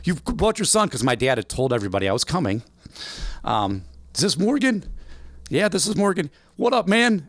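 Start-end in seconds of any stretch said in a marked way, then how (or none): 0.0-0.9 a rough imitation of Big Ed's accent. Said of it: "I won't do his accent right". 4.1-7.2 a rough imitation of Big Ed's accent. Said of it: "I won't do his accent right".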